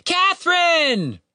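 very high, drawn-out call; long high-pitched searching shout. A narrator's reading. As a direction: holler a name across the woods. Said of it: "Tim shouted".

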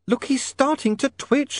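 high, slow lady's voice; alarmed young woman. Acted. Sacharissa alarmed for Otto.

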